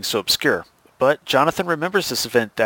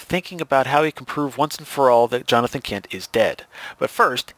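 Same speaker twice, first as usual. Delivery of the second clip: ordinary delivery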